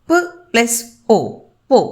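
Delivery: ప as a short bare p, then ఓ, then the joined పో; syllable split apart. Shown as a demonstration. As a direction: sound out ప and ఓ with pauses, then blend into పో. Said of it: "వీటిని విడగొట్టగలుగుతాము". (ప, ఓ విడదీసి పలికి పో గా కలపడం)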